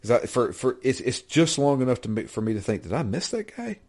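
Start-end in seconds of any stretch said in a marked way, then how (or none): 2.8-3.8 puzzled. Retold or imitated. Inner thought, puzzled.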